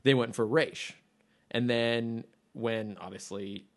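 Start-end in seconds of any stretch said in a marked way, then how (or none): 0.4-0.9 Raish said as "raysh", long A; Hebrew pronunciation. Shown as a demonstration. Host on the show's choice, Raish said as "raysh". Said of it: "deciding how to say his name".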